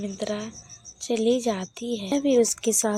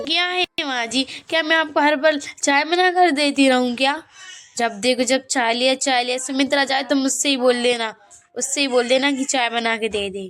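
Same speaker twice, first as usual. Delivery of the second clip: put-on louder, rushed complaining at the old woman; irritated outburst; 4 semitones higher, 7 dB louder, faster than usual. Daughter-in-law fed up with mother-in-law's tea requests, irritated outburst. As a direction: snap back, fed up with her demands.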